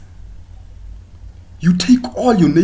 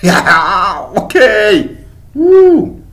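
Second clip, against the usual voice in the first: mock cheering; 6 semitones higher, 7 dB louder, much slower than usual. Mock hype shout.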